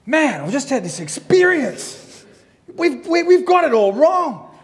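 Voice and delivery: excited revelation. Retold or imitated. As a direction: gush it out like a convert. Retold excited revelation to girlfriend.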